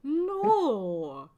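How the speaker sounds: high, slow, drawn-out disgust. Real, not acted. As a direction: recoil in mock horror. Disgusted protest at a crude joke.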